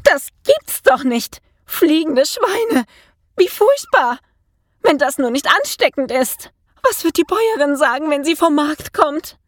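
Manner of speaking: high-pitched, aghast maid; shocked shrieking. A narrator's reading. Maid at the window sees flying pigs, horrified. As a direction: exclaim in high alarm, then fret. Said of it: "schrie sie".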